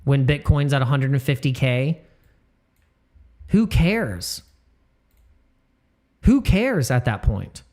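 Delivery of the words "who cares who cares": dismissive. Real, not acted. Dismissing small trades.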